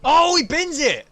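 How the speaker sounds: excited. Real, not acted. Sudden crash during race.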